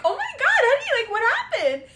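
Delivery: high laughing mock sympathy; mock concern. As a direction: lay on fake sweet worry, laughing. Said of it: "I'm like".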